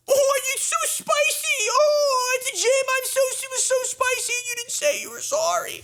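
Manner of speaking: very high, whining fake-crying voice; mock him like a sobbing toddler; imitating the boyfriend as a high, weepy whiner; whiny crybaby mock.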